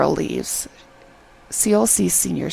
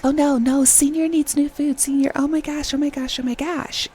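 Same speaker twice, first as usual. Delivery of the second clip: high-pitched imitation of a pushy girl; exaggerated fretting, pitched way up; mock fussing; 8 semitones higher than usual. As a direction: fuss over him in a high voice. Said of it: "as if that's not annoying enough".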